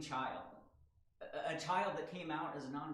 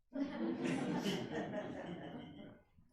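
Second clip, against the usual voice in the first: amused laugh; 7 semitones higher, 4 dB louder than usual. Real, not acted. Laughs after seed planted.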